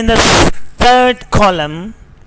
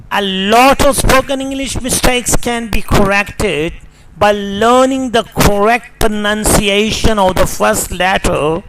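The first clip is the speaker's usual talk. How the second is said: ordinary delivery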